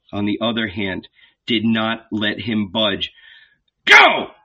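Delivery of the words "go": loud, high barked order; commanding shout. A narrator's reading. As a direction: bark the command. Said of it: "the red beard commanded".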